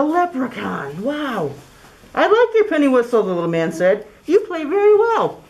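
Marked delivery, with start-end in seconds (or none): 1.0-3.2 leprechaun voice. Acted. 4.2-5.4 high, bright little leprechaun; squeaky high fairy-man voice. Acted.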